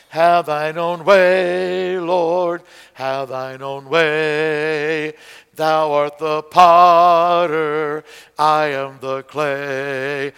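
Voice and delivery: slow chant, "Have" and "Thou" hit hard; stress on beat one. Shown as a demonstration. Teacher demonstrating first-beat emphasis to class, slow stressed. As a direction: lean on each downbeat, drawn out. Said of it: "I'm emphasizing beat one every time".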